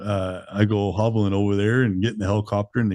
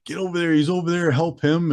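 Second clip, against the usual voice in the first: high urgent mock shout calling for help; retold yelling; 8 semitones higher than usual. Rescuers calling out to help the injured man, in a high urgent yell. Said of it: "hearing some yelling".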